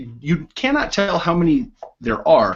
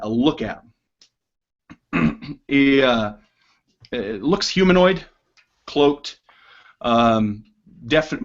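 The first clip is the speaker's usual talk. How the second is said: normal talking voice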